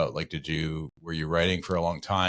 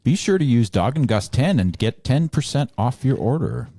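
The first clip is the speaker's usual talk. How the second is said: loud, raised-pitch promo announcement; ad read; 6 semitones higher, 8 dB louder than usual. Acted. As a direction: belt out the discount offer. Sponsor ad read.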